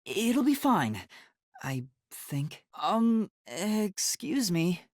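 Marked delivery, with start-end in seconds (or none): none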